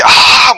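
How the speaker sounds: loud, high drawn-out "aah"; mock battle cry. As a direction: imitate an anime fighter yelling through an attack. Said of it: "二重の極み". (必殺技の叫び「あー」の物真似)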